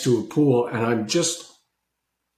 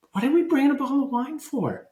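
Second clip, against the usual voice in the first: high, fast mock disbelief; incredulous scoff; 12 semitones higher, faster than usual. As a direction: act baffled, protest quickly and high. Retold own disbelief about wine.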